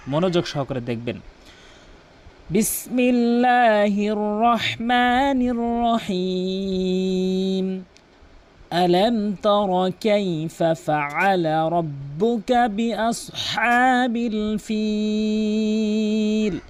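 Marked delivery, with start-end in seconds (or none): 2.5-7.8 slow, long-drawn tajweed chant; measured Quranic chanting; Quran recitation. Acted. 8.7-16.6 slow, long-drawn tajweed chant; measured Quranic chanting; Quran recitation. Acted.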